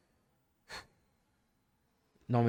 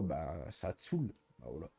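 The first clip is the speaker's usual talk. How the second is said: weary mutter; 9 semitones lower, 10 dB quieter than usual. Real, not acted. Fed up with repeating.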